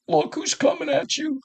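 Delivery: high-pitched mock warning from a bystander; imitated warning. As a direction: pitch it up high, like an onlooker tipping him off. Warning from onlookers retold.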